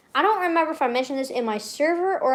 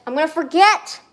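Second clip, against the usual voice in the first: louder, higher, exasperated cry; panicked outburst; 6 semitones higher, 6 dB louder than usual. Real, not acted. Streamer repeating the worry about a birthday, panicked. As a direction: burst out in rising panic.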